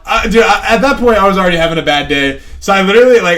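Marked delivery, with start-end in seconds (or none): none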